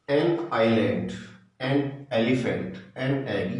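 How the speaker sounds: an island said very slowly, an stressed; slow dictation. Shown as a demonstration. Teacher reading examples slowly to students. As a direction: spell it out slowly for the class. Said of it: "ये सभी vowel से start है".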